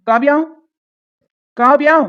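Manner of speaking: loud call with a rising end. Acted. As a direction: call her name out to summon her. Calls her from another room. (சத்தமா கூப்பிடுதல்)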